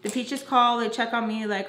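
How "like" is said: ordinary delivery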